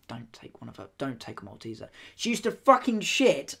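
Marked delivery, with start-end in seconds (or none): 0.1-1.9 hushed warning. Retold or imitated. Staff warning new nurses.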